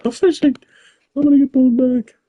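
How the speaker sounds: high, loud excited yelp; sudden excited shout; excited outburst. Real, not acted. Sudden excitement during game.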